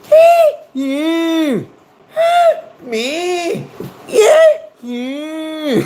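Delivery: very high, squealed Scottish syllables; mock Scottish accent. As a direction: squeak out a cartoon Scottish exchange, punching the short words. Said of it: "That's my Scottish conversation in two syllables".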